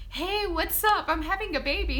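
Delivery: high, loud cheerful hello; bursting to share the news; excited greeting. Retold or imitated. Greeting an old friend at the front desk, excited and loud.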